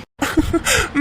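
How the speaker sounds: light, high chuckle; a short amused laugh at her teasing; soft laugh. Acted. Husband laughs at wife's teasing.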